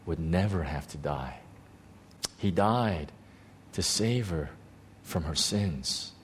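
soft, low, with long pauses between phrases; hushed realisation. Retold or imitated. Grieving grandson realising why Jesus died, hushed and tender. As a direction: let each phrase land quietly and fall.

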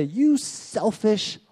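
hammed-up reproach of a selfish son; father mock-scolds his older son, slow and high; mock scolding father. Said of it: "He doesn't say".

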